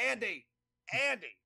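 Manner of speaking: high, loud urgent calling of a name; Max voice. Retold or imitated. Actor phoning in a panic, high loud call. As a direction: call out high and loud, a cranky old man. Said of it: "do the Max voice for all this".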